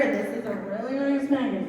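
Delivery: ordinary talk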